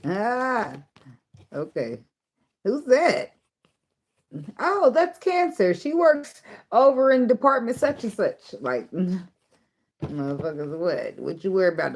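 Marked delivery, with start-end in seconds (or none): 2.6-8.6 high, slow curious coworker voice; high mock-gossip voice, drawn out. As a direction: mimic nosy coworkers asking about her. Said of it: "everybody's like".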